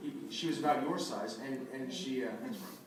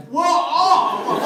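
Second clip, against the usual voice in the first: loud, high startled shout; startled yelp; 14 semitones higher, 16 dB louder than usual. Retold or imitated. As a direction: yelp in sudden alarm. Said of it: "I'm like".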